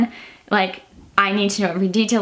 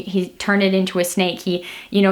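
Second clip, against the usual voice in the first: ordinary talk, nothing marked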